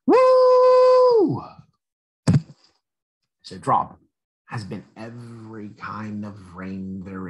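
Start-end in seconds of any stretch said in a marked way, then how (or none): 0.1-1.4 much higher, louder cheer; joyful woo. Acted. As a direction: whoop like riding a roller coaster. Drop celebrates becoming rain.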